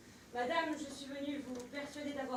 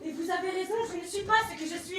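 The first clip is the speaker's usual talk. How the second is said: heated; 5 semitones higher, 7 dB louder, faster than usual. Acted. Heated riddling reply.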